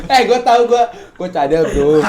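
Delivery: high, loud laughing through words. Real, not acted. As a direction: crack up at yourself. Speaker cracks up at own lisp.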